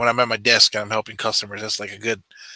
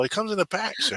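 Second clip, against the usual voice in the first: polite service voice; 10 semitones higher, 4 dB quieter than usual. Retold or imitated. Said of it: "And I go".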